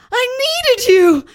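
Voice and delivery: soap-opera sob; hammed-up wailing. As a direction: cry it out full volume. Simon's tearful reproach.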